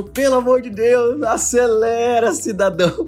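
high, slow, exasperated begging; exasperated plea. Retold or imitated. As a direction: plead with the slow old cab driver. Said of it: "Dando ré no carro, devagarzinho". (súplica exasperada ao taxista lento)

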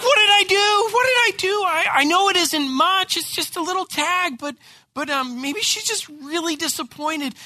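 panicked dad wondering what went wrong; worried panic. Retold or imitated. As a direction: fret out loud, second-guessing yourself. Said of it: "but I'm like".

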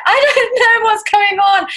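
high, drawn-out mock panic; nervous. First-timer flustered at the Chipotle counter, high nervous wail. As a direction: play up the flustered overwhelm, high and slow. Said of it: "I was really nervous".

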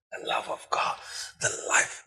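a tearful hush from the pulpit; choked whisper. Real, not acted. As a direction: let the words catch in your throat. Preacher overcome mid-sermon.

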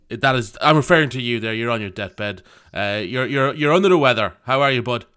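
normal talking voice

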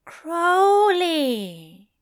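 calm him with his name; soothing. A narrator's reading. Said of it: "the angel soothed".

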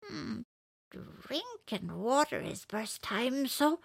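high, slow little bird voice; cartoon bird voice. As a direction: chirp it out as a small bird. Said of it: "Elephant thought to himself".

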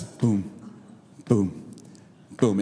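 deep, spaced-out booms; low booming footsteps. Retold or imitated. Coach's three steps shake the water, deep imitated booms. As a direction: drop low for each giant footfall.

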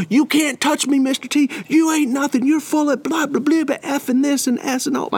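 high-pitched mock rant without real volume; imitated screaming tirade. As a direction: act out a defiant kid ranting on into blah-blahs. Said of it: "He's still screaming at me".

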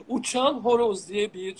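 ordinary talk, nothing marked

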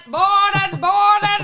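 loud high hawker's shout; market crier. Retold or imitated. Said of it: "Marktschreier schreit".

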